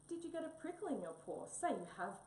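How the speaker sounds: begging. Acted. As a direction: beg for a patient to play doctor. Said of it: "begged Tabby".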